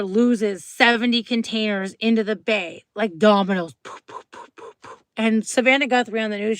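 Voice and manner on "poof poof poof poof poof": breathy unvoiced poof poof poof; soft voiceless popping sounds; whispered poofs. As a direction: puff out each poof like a toppling domino. Containers falling like dominoes.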